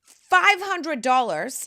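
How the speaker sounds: drawn-out mock horror at the price; incredulous. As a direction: stretch out the shocking sum. Exaggerated outrage at grocery bill.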